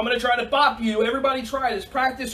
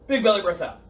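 ordinary delivery

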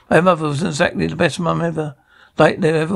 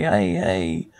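soothing; 10 semitones lower, 4 dB quieter than usual. Acted. Soothing crying baby at night.

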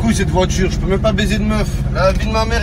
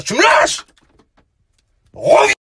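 calling out; 14 semitones higher, 4 dB louder than usual. Real, not acted. Calling out, high.

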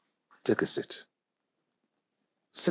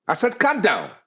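higher, forceful order; stern command; 10 semitones higher, 3 dB louder than usual. Acted. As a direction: rebuke your son and repeat the order. Father sternly orders son to calm down.

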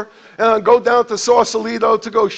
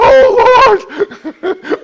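loud, much higher wail to the Lord; anguished cry; 12 semitones higher, 7 dB louder than usual. Retold or imitated. New believer crying out to God in public repentance, loud high wail.

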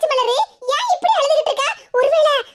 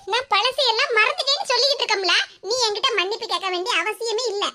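ordinary talk

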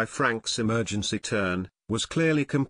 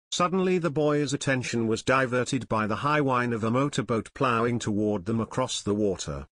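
ordinary delivery, nothing marked

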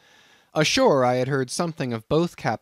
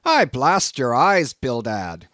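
loud, slow-hit curse, pitched up; angry cry; 7 dB louder, slower than usual. A narrator's reading. Peleg cursing Bildad over a stingy offer, crying out. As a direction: burst out at your partner. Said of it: "cried Peleg".

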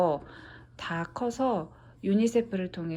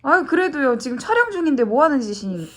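fast, loud teasing scold; playful scolding; 6 semitones higher, 5 dB louder, faster than usual. Real, not acted. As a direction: tease and scold quickly. Mother scolds during filming.

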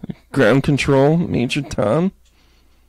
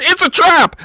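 high-pitched mock warning cry; 模拟惊呼警告. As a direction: cry out a hammed-up alarm. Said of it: "somebody to come flying in going".

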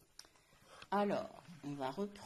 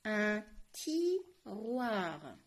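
slow syllables; much slower than usual. Shown as a demonstration. Teacher sounding out a word.

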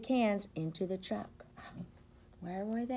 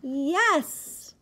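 high, loud cheer; excited yes; 8 semitones higher, 5 dB louder than usual. Acted. Reader cheers that the truck is finally full.

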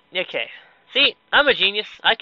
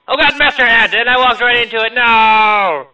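loud, high cry of dismay; dismayed outcry; 5 semitones higher, 8 dB louder than usual. Real, not acted. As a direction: yell in alarm at your own blunder. Game mishap, sudden outcry.

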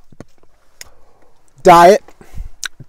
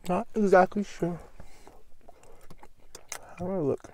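chewing while talking; eating a sandwich; mouth full; 3 semitones higher, 8 dB quieter than usual. Real, not acted. Seen 4: Bites sandwich at start, then visibly chews with full mouth throughout.

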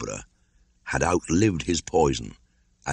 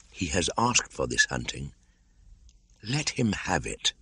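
quiet; 6 dB quieter than usual. Acted. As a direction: let it fall quietly, settled. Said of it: "he whispered".

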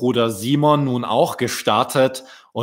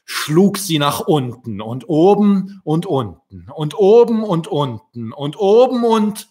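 building excitement; 6 semitones higher, 5 dB louder than usual. A narrator's reading. Wings beating faster and faster.

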